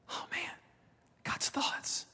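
breathy near-whisper of awe; hushed awe; awed hush. Real, not acted. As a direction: say it barely aloud, overwhelmed. Awed reaction after scripture.

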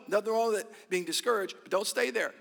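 usual speaking voice

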